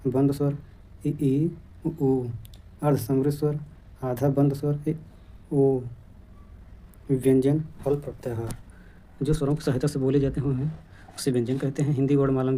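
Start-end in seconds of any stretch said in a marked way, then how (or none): none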